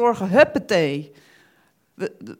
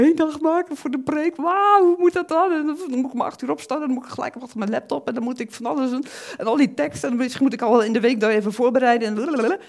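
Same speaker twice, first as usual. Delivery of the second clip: higher, fast panicky rattle; stressed self; 6 semitones higher, 5 dB louder, faster than usual. Retold or imitated. Preacher mimicking his own stressed self about sermon prep, higher and fast. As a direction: rattle off worries in a hurry. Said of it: "normaal gesproken zou ik denken".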